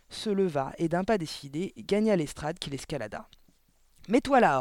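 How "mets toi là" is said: loud, high command; forceful headmistress command; barked order. A narrator's reading. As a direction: order the child into place. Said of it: "ordonna Le Gourdin".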